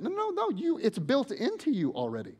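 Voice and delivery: loud, high, impassioned preaching. Acted. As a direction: drive it home to the congregation, full voice. Preaching at full force.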